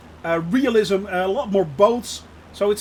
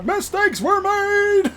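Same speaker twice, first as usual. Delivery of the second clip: slow, high, hammed-up confession; mock solemn; 12 semitones higher, 3 dB louder, slower than usual. Streamer admitting a flying blunder, mock solemn. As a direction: own the blunder with mock gravity.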